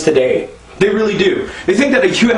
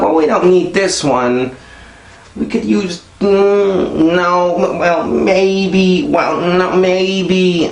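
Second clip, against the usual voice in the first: dithering wife; 3 semitones higher, much slower than usual. Retold or imitated. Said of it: "And like".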